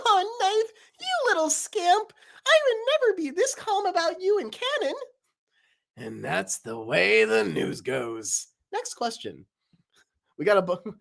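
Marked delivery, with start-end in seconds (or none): 0.1-5.0 very high fond scolding; Paper's voice. Acted. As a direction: chide fondly in a squeaky voice. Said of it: "Paper and Knife talking".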